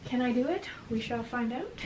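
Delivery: ordinary delivery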